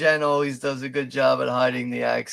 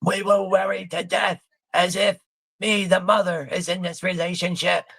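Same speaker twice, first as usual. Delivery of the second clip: higher-pitched mock mother, lightly done; 6 semitones higher than usual. Mocking the mother who acts like she's in the relationship, higher pitched. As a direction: mimic the fretting mother.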